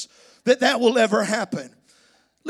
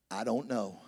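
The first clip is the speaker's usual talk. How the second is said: lowered mumbled mock answer; 11 dB quieter than usual. Spouse answer imitated.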